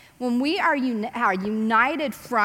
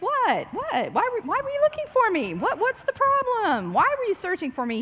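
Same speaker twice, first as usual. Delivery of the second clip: high, quick puzzled questions; high-pitched startled what, rapid and puzzled; bemused surprise; 10 semitones higher than usual. Retold or imitated. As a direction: toss the questions out, genuinely baffled. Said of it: "Jesus is like".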